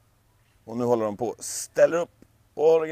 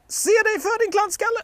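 much higher, loud snap at a clumsy fool; angry scolding; 8 dB louder than usual. Acted. A god scolding clumsy Heimdall, loud and high. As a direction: scold him sharply and high.

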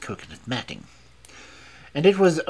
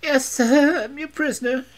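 put-on very high, louder foreign-accented woman's voice; foreign woman confessing; 13 semitones higher, 6 dB louder than usual. A narrator's reading.

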